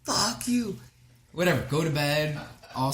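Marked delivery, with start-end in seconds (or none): 0.0-0.8 mild mock yell. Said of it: "I screamed".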